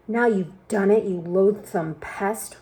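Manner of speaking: usual speaking voice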